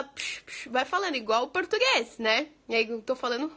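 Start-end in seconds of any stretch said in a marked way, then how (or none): 0.0-0.7 quick quiet "psh, psh"; whooshing bursts mimicking fast talkers; voiceless hissed whizzing noise; imitated rapid-speech noise. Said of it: "o pessoal fala".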